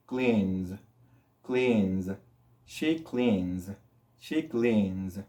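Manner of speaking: "cleans" ending in a voiced Z, kleenz; low, careful "cleans" with humming N into Z. Shown as a demonstration. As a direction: buzz the last sound of "cleans". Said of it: "va a producir un sonido de Z".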